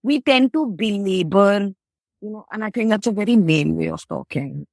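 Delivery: usual speaking voice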